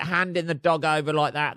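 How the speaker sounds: usual speaking voice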